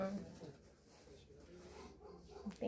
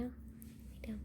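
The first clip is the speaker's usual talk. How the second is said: whispering; 20 dB quieter than usual. Real, not acted. Owner whispers to dog at vet.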